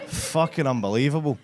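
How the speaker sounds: ordinary delivery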